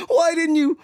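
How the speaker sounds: hammed-up complaint to mother; mock whiny son. Accusing mom in mock scene.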